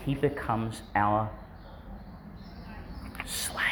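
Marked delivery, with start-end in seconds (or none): 3.3-3.6 near-whisper on a single word; hushed reveal. Real, not acted. Preacher revealing that God becomes our slave, hushed. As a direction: let it drop out hushed after a pause. Dramatic reveal after long pause.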